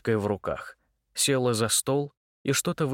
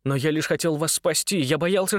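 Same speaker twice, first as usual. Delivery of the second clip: higher, fast nervous gabble; rattling off fast; 6 semitones higher than usual. Acted. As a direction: blurt it all out in a rush. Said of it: "затараторил он". (тараторит взахлёб)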